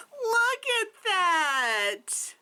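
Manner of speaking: high, long drawn-out coo; gushing. Real, not acted. Vlogger shows viewers her super cute find, gushing. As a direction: draw it out slowly, high and adoring.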